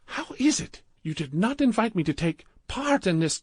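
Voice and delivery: put-on high, wounded heavy Indian accent; hurt at being left out; jealous Indian accent. A narrator's reading. Gupta complaining to Badrul, jealous Indian accent.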